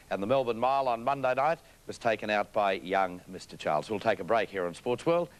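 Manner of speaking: normal talking voice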